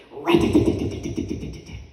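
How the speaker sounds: rapid-fire tongue-rattled flutter noise; quick run of percussive clicks; imitated rattling streamers. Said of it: "streamers ... that went".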